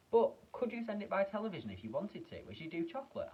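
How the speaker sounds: put-on high, pushy boy; lightly high boy asking eagerly; boy's voice. A narrator's reading. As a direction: press him like a demanding kid. Mike's voice in story.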